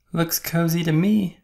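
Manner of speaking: high, bright-eyed little truck. A narrator's reading. Little Blue Truck asking the animals, high voice. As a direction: pitch it up like a small toy truck. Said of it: "said Little Blue Truck".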